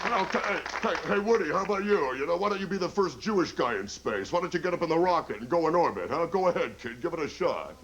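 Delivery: nudging Woody to go first; pushy comedian. Retold or imitated. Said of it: "It goes something like this".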